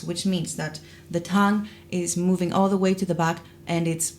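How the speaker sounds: ordinary talk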